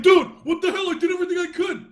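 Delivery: very high-pitched wounded protest; mock defensive. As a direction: whine back defensively, hammed up high. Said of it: "greeted by defensive maneuvers".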